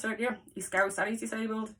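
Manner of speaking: ordinary talk, nothing marked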